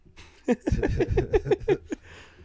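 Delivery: big high burst of laughter; cracking up loudly. Real, not acted. Laughing hard at his friend's joke, loud high laugh.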